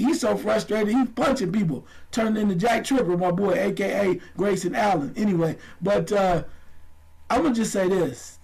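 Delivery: ordinary talk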